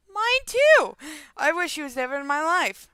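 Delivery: high, loud exclamation; sudden high-pitched agreement; loud outburst. Real, not acted. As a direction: blurt out your agreement at full voice. Outburst agreeing about stepdad.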